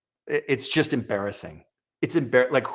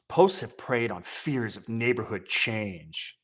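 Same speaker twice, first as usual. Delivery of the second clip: mock solemn reading; 2 semitones lower, 5 dB quieter, much slower than usual. Reading article text mockingly.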